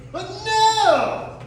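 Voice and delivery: loud outburst. Acted. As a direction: shout it out in frustration. Frustrated sidekick missing the list.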